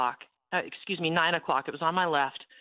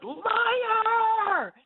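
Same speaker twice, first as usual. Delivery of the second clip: much higher, loud furious shout; 4 dB louder than usual. Retold or imitated. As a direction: hurl the word out in rage. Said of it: "I just shouted".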